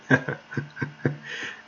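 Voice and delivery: soft unvoiced chuckle; breathy laugh. Real, not acted. Preacher chuckling at the verse, soft laugh. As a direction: let a quiet laugh slip out.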